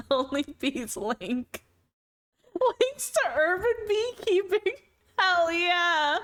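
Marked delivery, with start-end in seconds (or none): 2.6-6.2 excited. Real, not acted. Excited reaction to chat suggestion.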